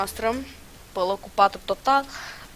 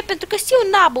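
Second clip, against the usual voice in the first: frustrated yell; 11 semitones higher, 12 dB louder than usual. Real, not acted. Frustrated gamer outburst.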